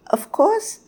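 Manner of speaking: high, squeaky little bird voice; tiny bird. A narrator's reading. Tiny bird carries on telling the king, squeaky. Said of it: "continued the tiny bird".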